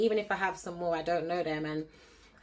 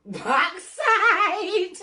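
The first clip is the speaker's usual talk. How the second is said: shout; 11 semitones higher, 7 dB louder than usual. Real, not acted. Shouted Jamaican exclamation laughing.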